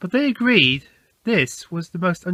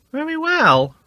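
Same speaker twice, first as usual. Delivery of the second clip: talking horse; 10 semitones higher, 3 dB louder than usual. A narrator's reading. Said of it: "replied the talking horse".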